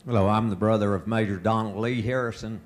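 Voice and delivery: usual speaking voice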